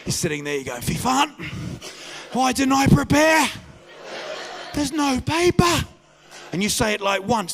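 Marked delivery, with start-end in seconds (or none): 0.8-5.9 high, raised-voice toilet panic; hammed-up distress; mock dismay. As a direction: wail it like someone caught out with no way out. Acts person stranded on toilet.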